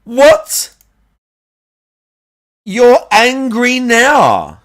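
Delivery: high, loud, slow disbelief; incredulous mockery. Real, not acted. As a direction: drag it out in mocking disbelief. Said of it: "saw how angry I was".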